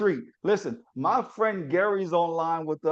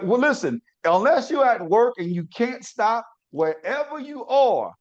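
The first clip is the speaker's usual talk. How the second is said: ordinary delivery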